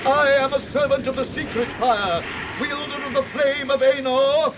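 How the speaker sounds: a commanding wizard standing firm; defiant wizard. Acted. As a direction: hold the bridge with stern authority. Wizard defies Balrog on bridge.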